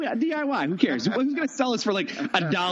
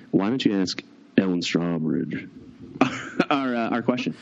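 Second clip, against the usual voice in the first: much lower, mock grand voice; mock deep announcer; 9 semitones lower than usual. Host handing over to Travis in a mock deep voice. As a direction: deepen into a pompous host.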